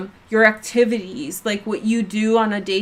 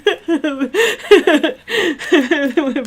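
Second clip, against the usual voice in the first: long laugh; 6 semitones higher, 4 dB louder than usual. Real, not acted. Laughing at own Borat.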